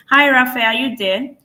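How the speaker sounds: ordinary delivery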